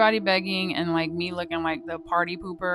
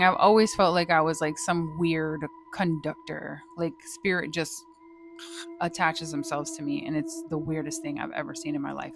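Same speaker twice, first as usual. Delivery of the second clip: usual speaking voice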